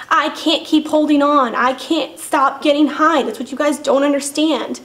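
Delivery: loud, high-pitched, pleading frustration; desperate protest. Retold or imitated. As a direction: push every phrase out in exasperation. Protesting to detox staff.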